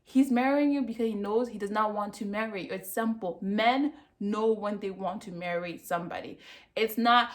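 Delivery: ordinary talk, nothing marked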